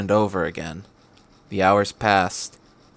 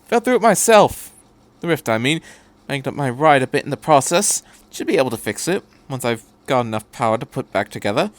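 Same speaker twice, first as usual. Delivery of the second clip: chatty Doctor. Acted. The Doctor rambling to Shinji about the tape, chatty. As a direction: babble happily about gadgets.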